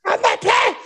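high shouted retort. Retold or imitated. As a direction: yell it back at him. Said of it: "retelling her reply".

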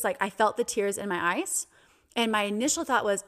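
ordinary talk

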